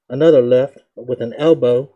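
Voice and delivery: usual speaking voice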